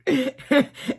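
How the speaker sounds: loud high burst of laughter; big high laugh. Real, not acted. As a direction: crack up loudly at the joke. Laughing at own joke.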